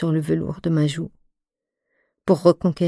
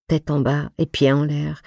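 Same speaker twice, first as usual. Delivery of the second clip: ordinary delivery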